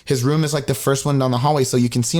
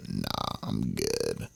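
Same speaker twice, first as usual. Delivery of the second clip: very low, quiet croak; hungover refusal; 14 dB quieter, much slower than usual. Retold or imitated. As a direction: croak it out, barely any voice. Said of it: "still hungover at, like, noon".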